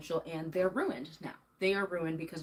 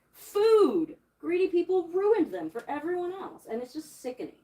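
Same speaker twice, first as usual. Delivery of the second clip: louder and higher, real anger spilling out; furious rant about greedy people; angry outburst; 9 semitones higher, 7 dB louder than usual. Real, not acted. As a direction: let the fury build on food and ruined.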